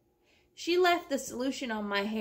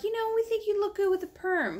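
mock sweet stylist voice; 11 semitones higher than usual. Stylists quoted with raised voice.

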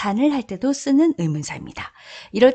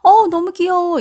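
higher, louder "너무 귀여워" squeal; gushing; 10 semitones higher, 6 dB louder than usual. Shown as a demonstration. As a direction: sound delighted by something cute. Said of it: "이 얘기니까 뭐야?". (높고 크게 귀여워하며)

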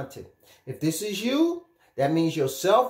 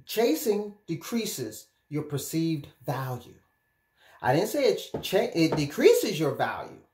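usual speaking voice

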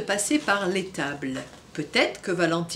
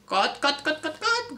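clucking rising higher; hen clucking; 5 semitones higher, slower than usual. Acted. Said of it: "gloussa Paulette". (gloussement de poule ravie)

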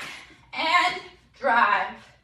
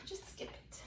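breathy whisper; 25 dB quieter than usual. Real, not acted. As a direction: whisper it to yourself.

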